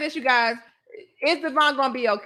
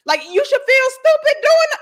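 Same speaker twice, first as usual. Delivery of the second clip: much higher, louder scornful outburst; exasperated; 13 semitones higher, 7 dB louder than usual. Real, not acted. To viewers, scorning people who film their crying, exasperated. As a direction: throw up your hands in scorn. Exasperated rant at selfie criers.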